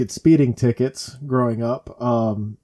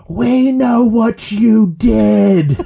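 high, loud, stretched-out holler; hammed-up mom yelling from a moving car; imitated yell; 8 semitones higher, 7 dB louder, much slower than usual. Mom to fleeing thief out the window, drawn-out yell. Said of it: "she yells out the window".

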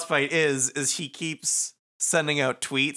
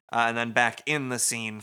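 ordinary delivery

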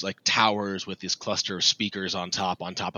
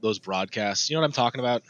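usual speaking voice

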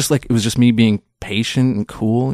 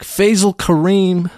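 slow, higher, presented like a star's name; showbiz name intro; 8 semitones higher, slower than usual. Pretend. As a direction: announce it with a mock flourish. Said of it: "So I'd be like".

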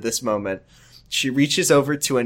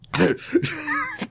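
high squealing laugh; 5 dB quieter than usual. Real, not acted. Cracks up mid-reading.

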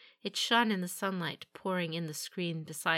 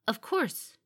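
higher-pitched, mildly surprised reply; betrayed surprise; 6 semitones higher, 3 dB louder than usual. A narrator's reading. Warrior answers the champion's unexpected order, surprise showing. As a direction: let surprise slip into a composed answer. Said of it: "her reply betrayed her surprise".

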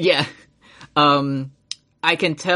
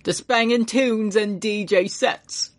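put-on slow, high Ironborn pirate accent; a gruff Ironborn islander, slowed down; 8 semitones higher, slower than usual. Joking to his cohost in an Ironborn accent. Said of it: "you sound like every unnamed Ironborn character".

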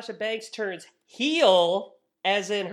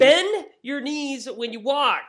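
mock coaching; 6 semitones higher, 5 dB louder than usual. Coaching Tamina, mock advice.

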